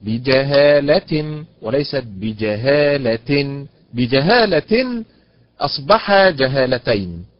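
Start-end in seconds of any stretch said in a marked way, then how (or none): 0.0-3.7 بجهالة said twice, stress shifting to the lam; stressed wrong then right. Shown as a demonstration. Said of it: "على حركة اللام". 3.9-5.0 بجهالة with the lam syllable raised high; stress on the lam. Shown as a demonstration. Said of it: "على حركة اللام".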